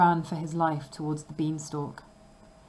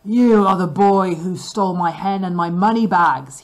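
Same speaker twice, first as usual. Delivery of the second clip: much louder ogre bellow; 11 dB louder than usual. A narrator's reading. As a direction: bellow the accusation. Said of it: "he bellowed".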